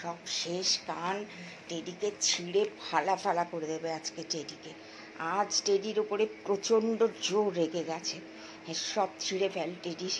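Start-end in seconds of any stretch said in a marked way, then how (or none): none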